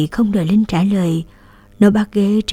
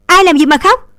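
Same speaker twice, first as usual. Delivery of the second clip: much higher, louder angry yell; 10 semitones higher, 7 dB louder than usual. A narrator's reading. Sister shouting at crying brother, angry. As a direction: shout at him in frustration. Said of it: "càng quát to".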